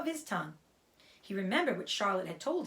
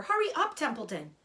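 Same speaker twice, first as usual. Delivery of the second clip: urgent; 6 semitones higher, 3 dB louder than usual. Acted. Wilbur urges the rat.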